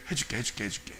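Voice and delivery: very soft, low, yielding murmur; giving-in Jesus. Acted. Jesus giving in to the believer's demands, softly yielding. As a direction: relent quietly, as if confessing. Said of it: "주님이".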